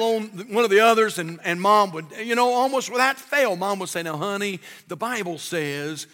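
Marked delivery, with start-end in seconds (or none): 4.0-6.1 gentle mom. Retold or imitated. Mom voiced in childhood story.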